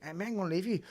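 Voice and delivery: hint of a pleading toddler; imitating a small child. Acting out his small son.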